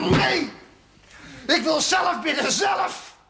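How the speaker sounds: high, loud shout with zelf hammered; a desperate man shouting that he will pray himself; furious shout. Acted. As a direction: roar your refusal at him. Refuses the priest's prayers.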